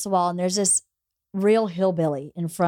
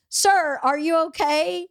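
retold judge; 7 semitones higher than usual. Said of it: "the judge goes".

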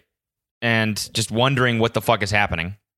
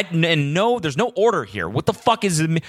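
usual speaking voice

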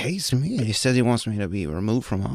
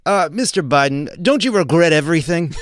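louder put-on reporter voice; mock reporter; 4 semitones higher, 6 dB louder than usual. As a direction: ham up a fawning question with a laugh. Mock press question to Biden.

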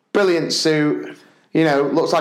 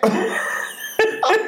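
airy disbelieving laugh; high breathy laugh. Real, not acted. Dad laughing at Santa's casual goodbye. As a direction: burst out laughing, no voice.

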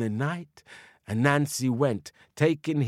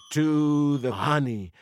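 usual speaking voice

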